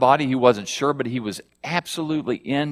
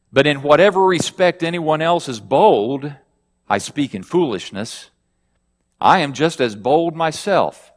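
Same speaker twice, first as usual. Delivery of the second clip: higher, louder reading of Paul's words; scripture reading; 3 semitones higher, 5 dB louder than usual. Acted. Preacher reading Paul's words to the congregation, raised reading voice. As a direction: read it out as Paul's bold boast.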